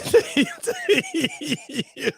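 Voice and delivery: laughing through the last words; breaking into laughter. Real, not acted. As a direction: quote dissolving into real laughter. Laughing fit while quoting wife.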